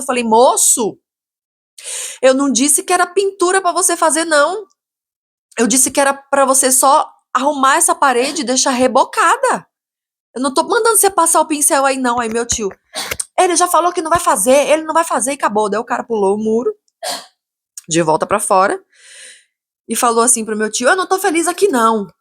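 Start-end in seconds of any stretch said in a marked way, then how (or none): 0.2-12.2 raised, high-pitched insistence; acting out heated frustration; retold exasperated rebuttal. Arguing back at the builder. 13.4-15.3 high-pitched, emphatic imitation; acting out impatient finality; retold insistent uncle. Uncle ending the argument, acted out. 20.9-22.1 high-pitched complaint; acting out aggrieved protest; retold disgruntled builder. Builder storms out, acted out.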